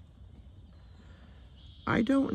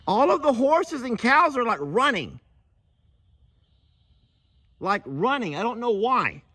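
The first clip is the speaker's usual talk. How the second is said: calling out; 11 semitones higher than usual. Real, not acted. Reporting to partner at a distance.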